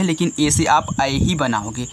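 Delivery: usual speaking voice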